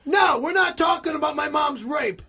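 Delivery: shouted, exasperated protest; outraged refusal. Real, not acted. Refusing a scene about his mom, outraged protest. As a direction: shut the topic down forcefully.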